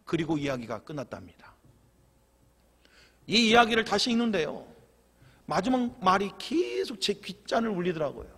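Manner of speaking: usual speaking voice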